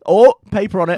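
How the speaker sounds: mock surprise. Man pretending to search rocks, mock surprise. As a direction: exclaim it as a pretend find. Acts out finding paper on rock.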